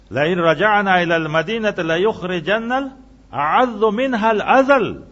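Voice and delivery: measured Quran recitation rising loud; Quranic recitation. Real, not acted. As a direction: intone the verse like tilawat. Quoting Quranic verse in Arabic.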